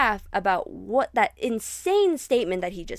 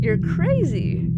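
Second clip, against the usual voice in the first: nervous laughing; 9 semitones higher, 4 dB louder, slower than usual. Retold or imitated. Laughing at a creepy remark.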